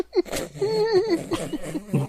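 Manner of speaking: high squeaky giggle. Real, not acted. Laughing at the homepage.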